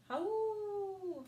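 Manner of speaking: long high wolf howl. Acted. Reading a wolf story, howling like the wolf. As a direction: throw your head back and howl.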